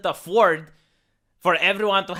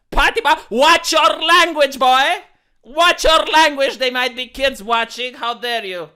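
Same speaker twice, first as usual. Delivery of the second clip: mock scolding; 5 semitones higher, 8 dB louder than usual. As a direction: scold the boy for swearing. Mock scold of foul language.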